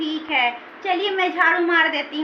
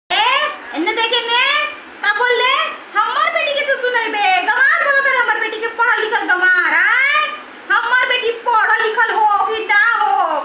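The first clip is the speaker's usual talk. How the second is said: loud, higher, furious mother-in-law telling off the maid; angry scolding; 6 semitones higher, 3 dB louder than usual. Acted. Mother defending her daughter, furious shouting at the maid.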